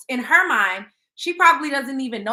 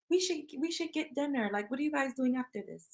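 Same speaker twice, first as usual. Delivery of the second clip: polite soft invite; 10 dB quieter than usual. Retold or imitated. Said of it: "I'm gonna be like".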